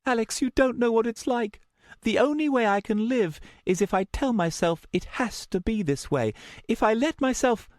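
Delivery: high, anguished confession; distressed. A narrator's reading. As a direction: plead urgently in a high voice. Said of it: "Luna drew in a shaky breath".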